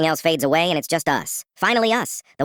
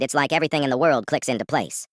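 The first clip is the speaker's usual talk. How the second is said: plain reading voice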